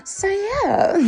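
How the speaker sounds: ordinary talk, nothing marked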